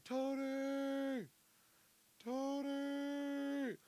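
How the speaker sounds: high, thin far-off calling of a name; a faint name cried from far off; distant call. Retold or imitated. Boss calling from across the club.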